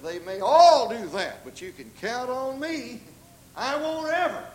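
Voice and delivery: loud, high, drawn-out vow; emphatic, self-assured pledge; bold assertion. Retold or imitated. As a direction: declare it boldly, leaning hard on the key words. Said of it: "make bold assertions and strong".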